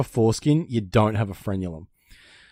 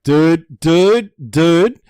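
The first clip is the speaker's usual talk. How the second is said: louder, higher, desperate dude dude; urgent dude; 3 semitones higher, 6 dB louder, slower than usual. Retold or imitated. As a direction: grab the doctor's attention in a panic. Bursting in to the doctor.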